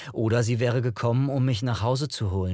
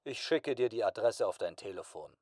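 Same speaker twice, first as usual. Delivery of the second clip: hushed boss; 8 dB quieter than usual. A narrator's reading. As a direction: say it quietly and discreetly. Boss quietly helps against the rules. (leise, gedämpfte Chefstimme)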